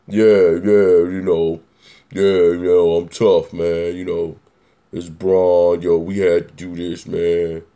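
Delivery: low, monotone tough-guy voice; LeBron James. Acted. Mocking how he does his NBA talk, tough-guy voice. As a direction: put on a deep tough-guy voice for the league. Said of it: "his tough guy voice".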